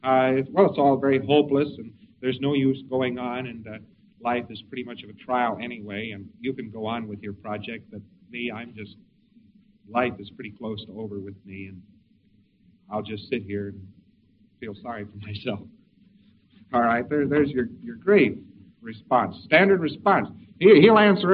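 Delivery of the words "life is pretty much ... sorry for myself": softer and lower, mopey self-pity; a mild mock lament, fading away. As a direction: sink into a sorry-for-yourself grumble. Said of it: "He says it's all hopeless anyway".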